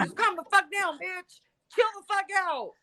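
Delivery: high-pitched retold telling-off; exasperated but not shouted; exasperated order. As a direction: re-enact ordering her to settle down, voice up. Retells ordering ex to calm down.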